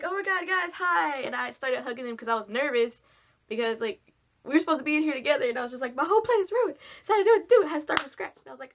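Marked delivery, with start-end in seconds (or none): 0.0-1.2 high excited greeting; nervous squeal. Retold or imitated. 5.9-8.4 high, louder flustered panic; panicked outburst. Retold or imitated. Panicking, plan ruined.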